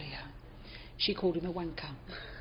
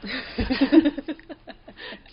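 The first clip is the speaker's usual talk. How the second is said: loud laugh; 7 semitones higher, 10 dB louder than usual. Real, not acted. Laughing at her own aside about Micah.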